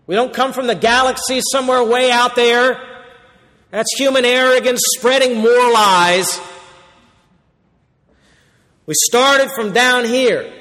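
high, loud and forceful rebuke; fervent preaching. Real, not acted. Preacher rebuking those claiming alien origins, loud and fervent.

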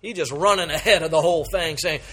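ordinary talk

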